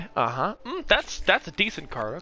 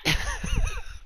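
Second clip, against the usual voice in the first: high laugh; 6 dB quieter than usual. Real, not acted.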